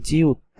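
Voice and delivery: জিউত said as Korean "ji-eut"; teacher naming the letter জিউত; Korean letter name. Shown as a demonstration. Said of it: "এইটা হচ্ছে".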